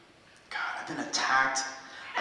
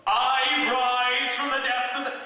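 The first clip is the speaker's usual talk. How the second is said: booming, slow, higher stage voice; big bold voice; 9 semitones higher, 5 dB louder, slower than usual. Retold or imitated. Imitating the old singer's big bold stage voice. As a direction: boom it out like an old rock singer. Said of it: "he just had this big, bold voice".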